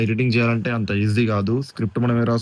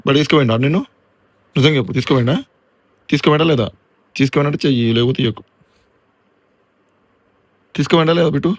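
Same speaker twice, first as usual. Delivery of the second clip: mock interrogation of dog; 6 dB louder, faster than usual.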